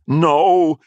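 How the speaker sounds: loud, high "no" cried out; high, forceful shout; cry of protest. A narrator's reading. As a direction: cry out to stop him. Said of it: "His cry of".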